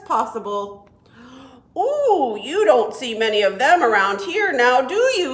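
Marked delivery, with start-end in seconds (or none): 1.8-5.3 frog squeezing words through a puckered mouth; tiny-mouthed frog. Acted. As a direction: say it with lips pursed small. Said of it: "he said".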